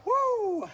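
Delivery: high joyful whoop; excited whoop. Real, not acted. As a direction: let out a thrilled woo. After the shot.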